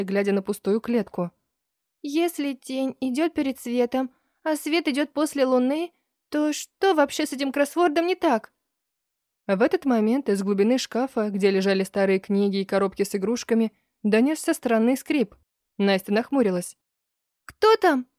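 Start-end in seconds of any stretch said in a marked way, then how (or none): 2.0-8.5 put-on lightly raised puzzled girl voice; a puzzled young girl. 17.5-18.1 high, louder startled "who's there"; startled girl voice. Acted. Girl calls into wardrobe.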